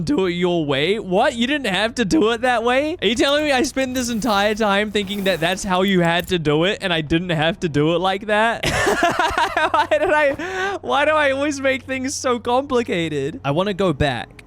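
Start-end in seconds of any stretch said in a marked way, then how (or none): 1.0-8.6 higher, indignant disbelief; can't believe it was that simple; baffled, rising disbelief; incredulous. Real, not acted. Disbelief at needless effort. 8.6-13.4 high, helpless laughing words; real self-mocking laughter, pitched high. Real, not acted. Laughing at own mistake.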